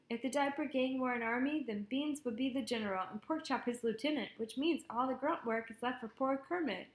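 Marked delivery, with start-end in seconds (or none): none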